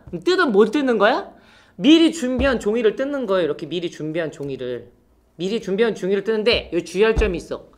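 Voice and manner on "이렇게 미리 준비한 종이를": ordinary talk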